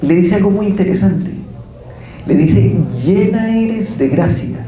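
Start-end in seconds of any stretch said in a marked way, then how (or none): 3.0-4.7 high and slow, each word drawn out; a slow, raised proclamation of grace; solemn slow proclamation. Acted. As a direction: announce it like the angel would, weighing every word. Preacher quotes the angel Gabriel.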